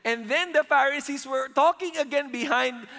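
normal talking voice

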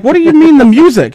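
high, baffled question; puzzled dad voice; dad confused by daughter. Retold or imitated. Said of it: "And I'm like".

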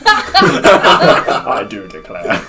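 slow, loud, drawn-out declaring; southern drawl. Retold or imitated. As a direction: stretch it out like a southern gentleman. Echoes the line, slow and loud.

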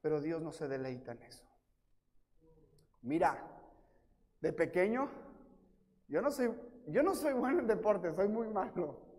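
ordinary talk

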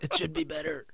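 odd high voice with airy breaks; changed voice. Pretend. Co-host reassuring the studio after his trance, in a changed voice. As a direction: put on a voice that has suddenly changed. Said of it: "My voice has just changed".

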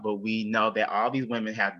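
usual speaking voice

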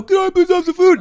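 very high, loud pleading screech; hammed-up shrieked plea; homeless man impression. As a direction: mimic a frantic man begging. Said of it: "Yeah, exactly like that".

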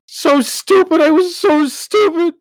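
very high, loud, sobbing cry; crying self-reproach. Acted. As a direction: wail at yourself in tears.

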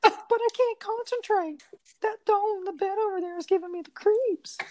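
put-on a scared girl in falsetto; man doing a woman's voice. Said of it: "gonna try to do some, like, voice acting here".

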